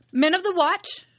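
high, loud shout to the crew outside; calling for the guard. Acted. Pirate queen summoning her guards, shouting high and loud. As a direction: bellow it through the door. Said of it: "had she raised her voice".

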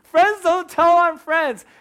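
high, loud whiny protest; mock angry kid. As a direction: squeal it like a furious fifth grader. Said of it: "so mad at him, I was like".

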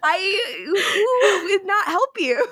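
laughing through the words; sympathy that keeps breaking into laughter; giggling, half-crying apology. Real, not acted.

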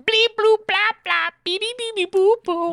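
very high, loud robot bleeps; robot beeps. Pretend. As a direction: beep like a cartoon robot. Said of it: "So here's what we're gonna say".

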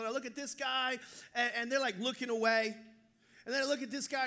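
usual speaking voice

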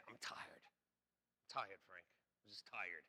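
weary half-whispered complaint; tired whisper. Retold or imitated. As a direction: sigh it out, drained and soft. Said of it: "I used to always say".

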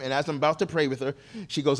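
ordinary delivery

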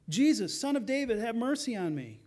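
high, loud cry for mercy; insistent yelling. Acted. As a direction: yell out over those hushing you. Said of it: "but he keeps yelling".

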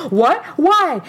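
a hint of mom's sharp questioning; imitated mom. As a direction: ask it like a baffled mother. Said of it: "She goes".

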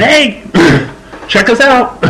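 high, drawn-out attention call; slow hail pitched well up, mock tweet. Host imitating his tweets to followers, calling out for attention.

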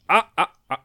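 petits cris d'effort plus forts; grognements d'effort. Real, not acted. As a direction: pousse en tirant sur le bouchon. Le bouchon cède enfin. (grognements d'effort)

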